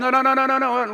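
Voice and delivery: high, loud hurried protest; alarmed no no no. Real, not acted. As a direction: quickly stop the mistake. Thinks he broke the AI.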